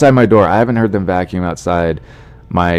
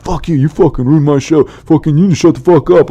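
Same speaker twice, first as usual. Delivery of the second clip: higher-pitched mock rant, swearing; angry comic; 8 semitones higher, 3 dB louder than usual. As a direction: play a furious comic tearing into a heckler. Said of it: "would just be, like, angry".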